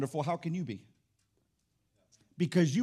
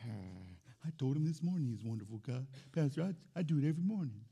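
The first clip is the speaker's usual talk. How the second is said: mock defensive murmur; 6 semitones lower, 9 dB quieter than usual. Congregant voiced, defensive.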